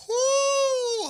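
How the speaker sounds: sudden high whoop of excitement; excited whoop. Real, not acted. Commentator reacts to a big punch with a loud whoop. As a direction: yelp it out as the punch lands. Big overhand right lands.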